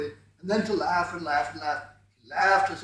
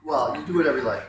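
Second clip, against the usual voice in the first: ordinary delivery, nothing marked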